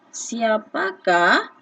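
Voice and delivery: "siapakah" drawn out slowly, syllable by syllable; teacher's slow model word; slow demo. Shown as a demonstration. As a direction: stretch the question word slowly for pupils. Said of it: "Tengok soalan ni".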